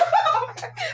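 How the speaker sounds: a short, high, delighted laugh; squealing laugh of real joy; high giddy laugh. Real, not acted. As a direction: let a happy giggle escape. Thrilled unboxing dream bag.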